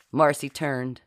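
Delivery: ordinary delivery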